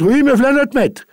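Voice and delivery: high, loud reply up the stairs; called-back answer. Acted. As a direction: answer him from far off, nervously.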